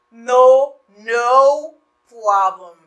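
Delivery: "No" punched loud, then "problem" drawn out slowly; loud, slow reassuring no problem; slow emphatic. Shown as a demonstration. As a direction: lean hard on "No" and stretch it. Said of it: "Li son ka chanje entonasyon yo".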